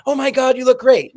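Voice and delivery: high, loud gushing praise; gushing excited. Retold or imitated. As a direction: gush over how great they look. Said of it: "And then".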